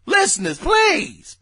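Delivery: ordinary talk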